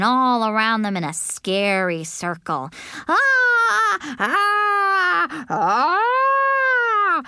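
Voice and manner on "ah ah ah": long, high screams; sustained high child's shriek. A narrator's reading. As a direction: scream to frighten, held out. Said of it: "I screamed".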